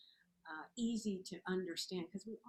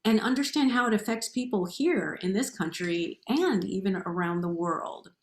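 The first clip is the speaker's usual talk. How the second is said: loud, upbeat show host intro; announcer voice; 14 dB louder than usual. Acted. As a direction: introduce the guest like a presenter.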